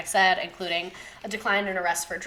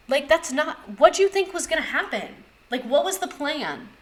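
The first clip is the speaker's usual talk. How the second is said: exasperated disbelief; 8 semitones higher, 3 dB louder than usual. Real, not acted. Ranting at Prohibition planners.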